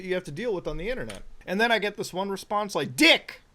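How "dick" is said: sudden high, loud yell; mock insult shout. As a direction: blurt it out as a hammed-up insult. Reads out abrupt one-word message.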